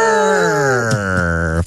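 hammed-up pirate arr; pirate growl. Pirate growl, Talk Like a Pirate Day.

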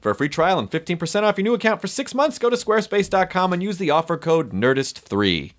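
upbeat sponsor read. Acted. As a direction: read the ad brightly. Reads sponsor ad.

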